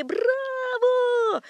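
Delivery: very high, drawn-out cry; crowd cheer. A narrator's reading. Whole audience cheers Napoleon drinking, crowd cheer. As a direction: hold the cheer long and high. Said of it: "Все закричали".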